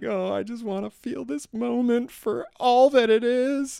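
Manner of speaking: high, emotional, teary voice; tearful. Retold or imitated. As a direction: hold the moment through tears. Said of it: "And I was just like".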